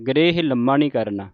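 normal talking voice

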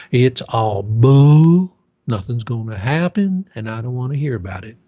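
mock a loud naysayer who waves it all away; imitated scornful denial, stretched then hurried; dismissive skeptic.

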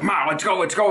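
high urgent call to mom, pushing her out. Retold or imitated. Son bursting into mom's house, urgently calling her. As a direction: hurry her out the door. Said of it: "and I was like".

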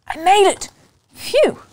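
high, loud "made it" then a relieved sigh; boy's giddy relief; relieved triumph. Acted. Boy relieved after sneaking home.

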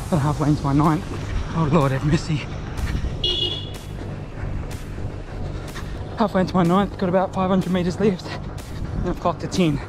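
breathless near the finish; gasping out words mid-stride; while running. Real, not acted. Finishing the run.

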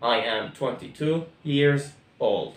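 said very slowly, word by word; teacher modelling the full sentence; slow, clear classroom drill; slow model sentence. Shown as a demonstration. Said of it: "نكملها حتكون".

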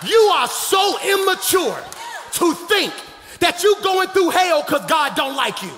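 high, loud, shouted exhortation; full-force preaching. Real, not acted. Preacher telling the congregation God uses their problem, shouted preaching. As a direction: cry it out to the whole church, phrase by phrase.

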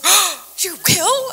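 hammed-up astonished shout; mock surprise. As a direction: act the colleague's startled delight. Colleague surprised at answer.

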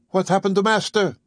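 sudden high startled question. A narrator's reading. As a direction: jump at the sound, alarmed. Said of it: "very startled".

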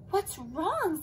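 high anxious question; fearful. Acted. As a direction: ask it with worried eyes. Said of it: "said Anteater fearfully".

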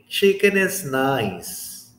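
slow and deliberate, each phrase set apart by pauses; slow model answer. Shown as a demonstration. Teacher slowly models ranking chicken, fish and beef. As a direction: lay it out slowly with clear breaks. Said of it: "you can say".

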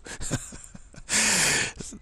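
a high breathy burst of laughter. Real, not acted. Laughter after agreement.

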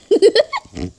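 uncontrolled little laugh; giggle. Real, not acted. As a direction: giggle for no reason. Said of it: "I don't know why I'm laughing".

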